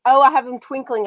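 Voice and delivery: ordinary talk, nothing marked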